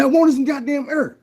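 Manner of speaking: high, loud, furious yell in a put-on voice; the half-drowned man yells at the mentor, outraged; frantic outrage. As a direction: scream it, indignant and panicked.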